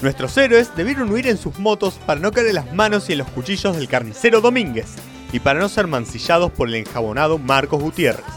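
normal talking voice

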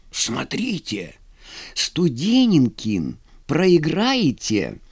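slow, spaced-out caution; warning. A narrator's reading. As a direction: warn him word by word. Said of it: "предупредил стоявший рядом немолодой сотник". (медленное предостережение)

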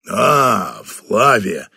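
put-on deep, calm older boss; low, unhurried greeting from the boss; low general voice. Batanda greets Flavia.

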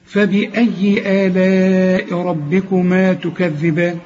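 slow melodic tajweed chant; Quran recitation. Shown as a demonstration. Teacher recites the refrain slowly as a melody.

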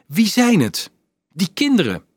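high, pressing questions; insisting. A narrator's reading. Dolf pressing Leonardo about the children, insistent. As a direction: push hard for an answer. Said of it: "hield hij aan".

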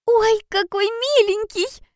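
put-on very high, drawn-out girlish squeal of delight; delighted high-pitched coo; joyful squeal. A narrator's reading. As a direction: squeak it with joy, slowly and way up high. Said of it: "радостно пропищала Ода".

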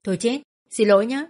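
teasing, fake sorry; mock apology. A narrator's reading. Nam mockingly apologises to the robber under his foot. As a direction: apologise with a smirk. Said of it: "Nam cười".

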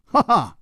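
high, light ha-ha; short amused laugh. A narrator's reading. Hero chuckles under attack, high short laugh. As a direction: laugh before paying a compliment. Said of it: "张啸林微笑着说".

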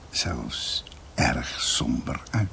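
slow, hushed and grim; slow whisper. Acted. Narrator closes the grim family history in a slow whisper. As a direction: let it sink into a dark whisper.

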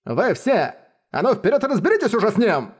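high, spiteful barked order; snide command. Acted. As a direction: sneer the order at them. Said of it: "Закончил он с ехидным смехом".

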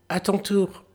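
high taunting voice; taunting little tailor. A narrator's reading. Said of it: "dit-il".

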